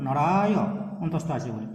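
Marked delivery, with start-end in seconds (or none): 0.1-0.5 নরায় with a long আ and a sounded "yo"; careful demo of নরায় ending on a vowel; open final vowel. Shown as a demonstration. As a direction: draw out নরায় and open the last syllable. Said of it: "হসন্ত নেই বলে".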